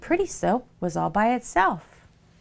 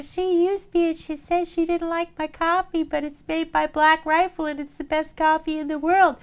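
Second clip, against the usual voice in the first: high, aggrieved little soap voice; hurt pretty soap; 9 semitones higher than usual. Acted. Pretty soap complains to ugly soap, high and wounded. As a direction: whine it up high, feelings hurt.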